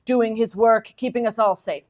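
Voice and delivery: a lightly hammed-up storybook narrator voice, slowing at the end; mock grand novel narration. Reading a mock line from the novel to the audience, lightly hammed-up narration. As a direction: read it like a solemn Victorian narrator, then undercut it. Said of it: "there'll be a line like".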